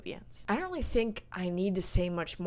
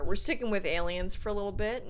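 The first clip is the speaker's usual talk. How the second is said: usual speaking voice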